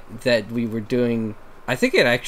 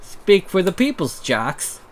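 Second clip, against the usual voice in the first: higher, loud character voice; Pepper voice; 8 semitones higher, 5 dB louder than usual. Acted. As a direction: stay in Pepper. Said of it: "channel Pepper".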